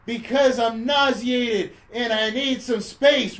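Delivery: loud, high, slowly stretched complaint; mock exasperated girlfriend. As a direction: act out a fed-up breakup. Acted breakup from the film.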